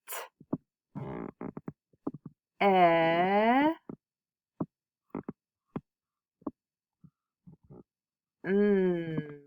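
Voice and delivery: "t, eh, mm" as separate drawn-out sounds; sounding out. Shown as a demonstration. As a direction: break "t, eh, mm" into single sounds. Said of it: "So we have".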